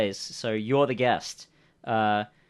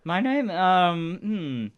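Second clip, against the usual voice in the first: higher-pitched, drawn-out hesitant character voice; stalling stranger; 8 semitones higher, 4 dB louder, much slower than usual. Acted. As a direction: slowly stall while inventing a name. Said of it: "he's looking around".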